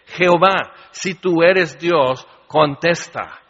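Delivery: loud, slow call to heaven; solemn cry. Acted. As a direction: cry out to God, slow and loud, daring him to answer. Elijah calls on God at altar.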